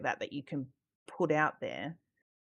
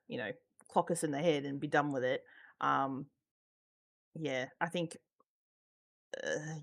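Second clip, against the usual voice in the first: usual speaking voice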